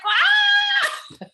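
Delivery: very high, loud mock shriek; imitating a child's piercing scream; retold scream. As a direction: wail like the hurt child.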